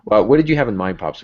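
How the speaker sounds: ordinary talk, nothing marked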